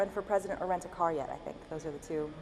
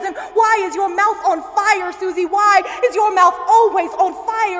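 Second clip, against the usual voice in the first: loud, higher cry of the accusation; shouted climax; 10 semitones higher, 14 dB louder, slower than usual. Acted. Poet at the poem's peak, shouting at Susie. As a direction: hurl it at Susie.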